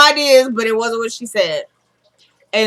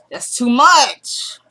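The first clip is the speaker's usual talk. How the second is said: drawn-out, louder mock exasperation; exasperated; 4 semitones higher, 5 dB louder, much slower than usual. [name] reacting to how much Jeffree has shared, exasperated. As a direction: stretch it out in theatrical disbelief.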